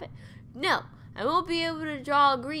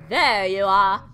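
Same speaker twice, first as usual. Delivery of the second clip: louder put-on unknown accent, stressing There; 3 semitones lower, 9 dB louder, slower than usual. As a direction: greet her in an odd unplaced accent. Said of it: "I don't know what kind of accent this is".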